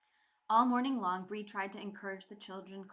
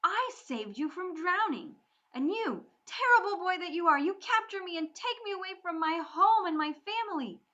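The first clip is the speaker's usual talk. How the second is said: loud, high furious outburst; exploding anger; 10 semitones higher, 11 dB louder than usual. Acted. Bree exploding at her captor, furious. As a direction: let it burst out of her, fast on the insult. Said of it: "Bree exploded".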